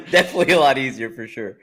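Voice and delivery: laughing while agreeing; chuckling through the line. Real, not acted. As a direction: breaks into laughter on the words.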